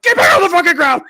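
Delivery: very loud, high police yell; shouted command. Retold or imitated. Cop as suspect pops back up, sudden yell. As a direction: explode into a full shouted order.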